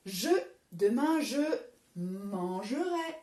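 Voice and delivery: slowly, syllable by syllable, "mangerai" drawn out for writing; teacher dictating "mangerai" slowly; slow dictation. Shown as a demonstration. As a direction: spell out "mangerai" slowly for the class. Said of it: "Manger à l'infinitif".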